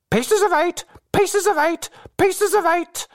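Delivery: very high-pitched parrot squawk. A narrator's reading. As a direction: screech it out like a parrot, rapid and repetitive. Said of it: "the parrot called out with great rapidity".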